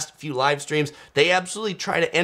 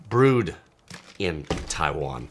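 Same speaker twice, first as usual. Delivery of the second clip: ordinary delivery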